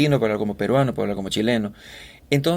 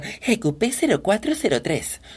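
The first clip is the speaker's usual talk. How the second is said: higher, slow character read of the plate; a third voice; 8 semitones higher than usual. Shown as a demonstration. Voice actor's third take on the plate, slow and higher. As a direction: drag it out in yet another voice. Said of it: "Hago cualquier voz".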